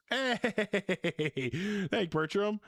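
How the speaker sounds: high, laughing thanks. Real, not acted. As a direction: blurt thanks through a laugh. Delighted laugh at a donation.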